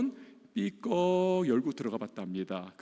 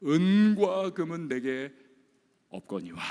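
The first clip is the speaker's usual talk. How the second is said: slow, weighty proclamation falling away at the end; solemn apostle line; 4 dB louder than usual. Acted. Preacher quoting Peter to the beggar, slow and solemn. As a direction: lay down each word slowly, then let it drop. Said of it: "베드로와 요한의 말을 들어보세요".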